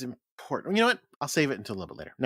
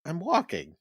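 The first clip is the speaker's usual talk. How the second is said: higher put-on New York protest; mock New York accent; 4 semitones higher than usual. Pedestrian protesting to a driver, mock New York accent. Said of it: "what you say up there".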